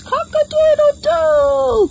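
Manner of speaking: high, loud drawn-out crow; rooster crow. Shown as a demonstration. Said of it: "And a rooster says".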